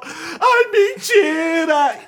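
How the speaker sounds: imitating a friend bursting into tears, high and loud, "mentira" dragged out slowly; crying disbelief. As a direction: wail it like a friend who just heard the bad news. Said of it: "ela começou a chorar e falou".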